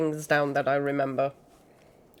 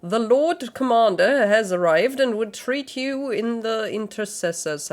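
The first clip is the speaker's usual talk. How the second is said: put-on raised, loud theatrical read of old-style lines; dramatic reading; 4 semitones higher, 4 dB louder than usual. Streamer voicing a knight's dialogue, grand and theatrical. Reads game dialogue aloud.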